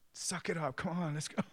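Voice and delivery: high-pitched mock urging; hammed-up impatient coach; tough coach dad. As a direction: push the player on, no sympathy, imitated. Coach urging his crying daughter.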